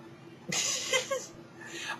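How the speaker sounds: high burst of laughter; laughing at his own defiance. Real, not acted. Laughs after outburst.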